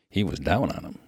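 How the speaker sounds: plain reading voice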